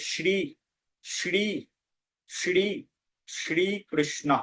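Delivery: শ্রী with a palatal "sh" and long ee, "shree"; correct Sanskrit pronunciation. Shown as a demonstration. Teacher demonstrates for learners, correct Sanskrit pronunciation. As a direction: draw out "shree" in শ্রীকৃষ্ণ, tongue to the palate. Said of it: "সঠিকভাবে উচ্চারণ করতে চাইব".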